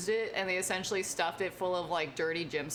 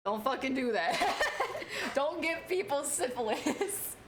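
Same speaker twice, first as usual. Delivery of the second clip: high laughing scolding; 7 semitones higher, slower than usual. Real, not acted.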